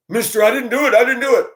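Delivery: high, pleading kid imitation; protesting kid. Schoolkid to bus driver coming down the aisle, high mock protest. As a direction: imitate a kid hurriedly denying blame.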